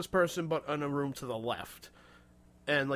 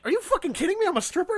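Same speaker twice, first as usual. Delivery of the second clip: much higher, louder stunned disbelief; disbelieving outburst; 5 dB louder, faster than usual. Retold or imitated. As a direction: yelp it out in mock shock, high and loud. Retold disbelief at the guess.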